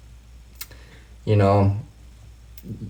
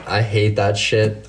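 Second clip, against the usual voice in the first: normal talking voice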